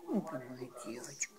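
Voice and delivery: low, fast tender whisper. Real, not acted. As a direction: whisper lovingly to a pet. Soothing the pet. (нежный шёпот)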